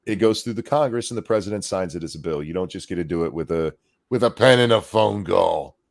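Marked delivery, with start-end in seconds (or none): 4.1-5.8 slow, drawn-out presidential parody, louder; Obama impression. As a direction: draw it out loud like a mock politician. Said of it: "That's my new Obama".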